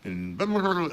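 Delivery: ordinary delivery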